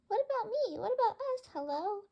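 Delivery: indignant calling out. Acted. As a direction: shout to be noticed, left behind. Birds left out, calling.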